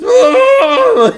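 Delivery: high, loud imitation of a baby's wail; mock infant shriek of outrage; pissed-off baby scream. Dad replaying his daughter's rubber-duck tantrum, pissed-off scream. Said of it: "She was pissed".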